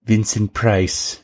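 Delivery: slow, drawn-out horror-actor name drop; lightly put-on impression, stretched out; Vincent Price. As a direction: draw the name out in character. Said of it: "That's my Vincent Price impression".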